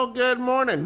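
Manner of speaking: imitating chirpy listeners calling in; cheery caller. Listeners greeting on the phone.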